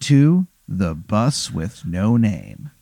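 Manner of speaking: slow, grand character introduction; measured showman's greeting, word by word; theatrical bus driver. Acted. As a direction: welcome them aboard with drawn-out ceremony. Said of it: "and he goes".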